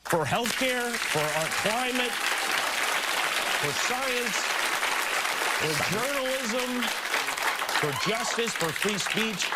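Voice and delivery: choking back real tears; crying. Real, not acted. Late-night host breaking down on air, high, tearful. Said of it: "he starts crying".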